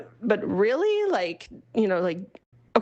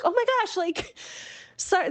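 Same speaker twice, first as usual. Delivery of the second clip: high, bright-eyed gush of surprise; gushing reply; 12 semitones higher than usual. Retold or imitated. Replying to a friend who almost cried, high flustered gush. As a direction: squeal it like you're touched and flustered.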